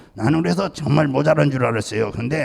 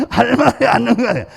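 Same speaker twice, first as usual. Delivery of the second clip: words spilling through laughter, higher; laughing while speaking; 8 semitones higher, 5 dB louder than usual. Real, not acted. Pastor telling the congregation about the silent husband while laughing.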